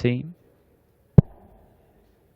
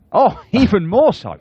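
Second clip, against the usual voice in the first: laughing surprise; 13 semitones higher, 7 dB louder, slower than usual. Real, not acted. Commentator reacts to near miss.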